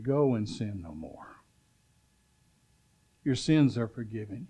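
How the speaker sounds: Jesus quietly forgiving a woman on her knees; tender Jesus. Acted. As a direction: speak it gently, like pardoning someone at your feet.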